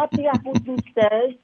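playful Cockney demo; Cockney accent. Shown as a demonstration. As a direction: rattle off rhyming slang in Cockney. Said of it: "the Cockney accent".

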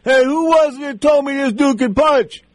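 hammed-up retelling of a boxer hollering after the fight; retold shout. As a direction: yell it out from under the towel, stunned by the punch.

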